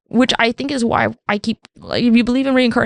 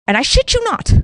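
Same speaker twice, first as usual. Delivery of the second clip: normal talking voice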